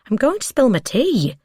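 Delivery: high-pitched protest; playful protest. A narrator's reading. Woman fends off partner's wandering hand, high protest. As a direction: pitch it up high as you object.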